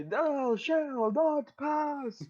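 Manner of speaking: high, very slow booming wizard; Gandalf voice. Acted. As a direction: proclaim it like a wizard blocking a bridge. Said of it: "Get out a Gandalf stick and say".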